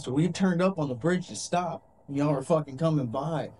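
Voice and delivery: normal talking voice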